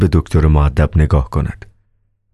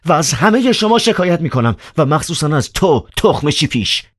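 high, heated and insulting; agitated threat; 11 semitones higher than usual. Acted. Ivan threatening Riukhin, high and heated. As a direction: fume at Riukhin, pitched high. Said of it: "ایوان هیجان زده".

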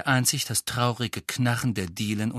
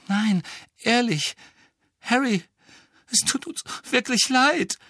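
desperate; 13 semitones higher, 4 dB louder than usual. A narrator's reading. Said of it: "sagte Hermine verzweifelt".

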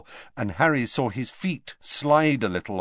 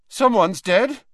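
much higher, louder, strained; voice going up an octave; 13 semitones higher, 8 dB louder than usual. A narrator's reading. Frightened Malfoy blurting to the headmaster, high and loud. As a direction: crack up high with panic. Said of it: "voice seemed to go up an octave".

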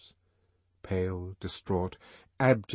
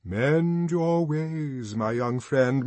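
slow, louder, grave warning; sepulchral; 6 semitones higher, 4 dB louder, much slower than usual. A narrator's reading. Songster warning Bernard, slow sepulchral voice. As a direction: intone it like a tomb. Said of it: "His voice became sepulchral".

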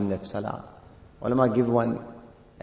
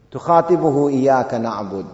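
poem recitation; 5 dB louder, faster than usual. Acted. Quotes poem line.

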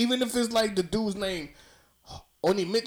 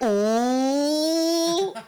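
mock ooh; 9 semitones higher, 5 dB louder than usual. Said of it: "I was like".

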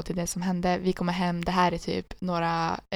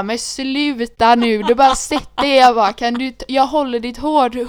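imitating self; 4 semitones higher, 8 dB louder than usual. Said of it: "Och jag bara".

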